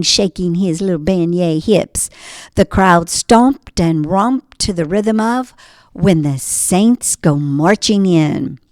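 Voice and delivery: plain reading voice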